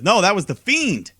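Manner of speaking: loud, high-pitched quoted outburst; mock-dramatic cry of correction; emphatic correction. Acts out Bray's emphatic correction.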